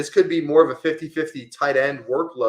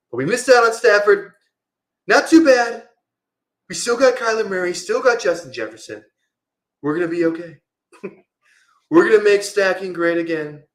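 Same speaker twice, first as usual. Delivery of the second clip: higher, louder amateur presidential impression; rally-style Trump cadence, pitched up and projected; Donald Trump; 7 semitones higher, 6 dB louder than usual. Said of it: "So, again, not that great".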